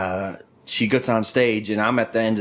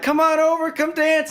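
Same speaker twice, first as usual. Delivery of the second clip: teasing call. Retold or imitated. Friend calling the dancer over, high teasing call. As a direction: lure her over mockingly. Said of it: "He starts trying to get her over".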